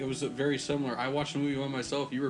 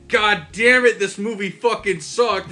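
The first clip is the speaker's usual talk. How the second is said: loud, higher, slow mock rant; mock frustrated yell; 6 semitones higher, 10 dB louder, slower than usual. Imitating his friend's rant at bad movies, loud and higher. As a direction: ham up a friend's movie rage. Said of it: "It's just what I hear from you".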